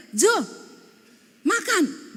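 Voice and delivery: high-pitched call to her husband; retold yell. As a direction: call out sharply, pitch jumping up. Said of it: "saya teriak". (teriakan memanggil suami, nada tinggi)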